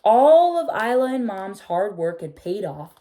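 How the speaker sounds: put-on gentle read-aloud for children, telling the tale; storybook reading. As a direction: read it like a bedtime picture book. Reading picture book aloud.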